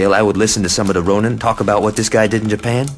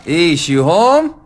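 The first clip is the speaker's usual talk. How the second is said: called out loud and slow, up high; calling out; 12 semitones higher, 5 dB louder, much slower than usual. Acted. Johnny calling for his girlfriend at home, loud and drawn out. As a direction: call into the empty house, drawn out.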